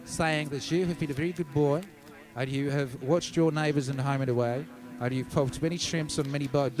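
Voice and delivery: mock Australian leader's TV address, badly faked accent; botched Australian accent, measured like an official announcement; terrible Australian accent. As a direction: put on a silly Australian accent. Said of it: "I've got a terrible Australian accent".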